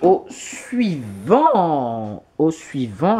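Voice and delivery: very slow, stretched announcement; playful long-held call for the next item; drawn-out announcement. Pretend. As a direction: draw each syllable right out.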